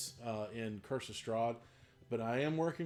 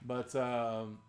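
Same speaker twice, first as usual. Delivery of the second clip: ordinary talk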